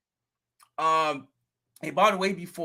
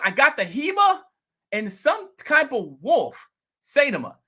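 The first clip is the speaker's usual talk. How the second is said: bragging about his coin, pumped up; imitating the neighbor; 7 semitones higher than usual. Neighbor bragging to the vlogger about his coin, excited higher voice.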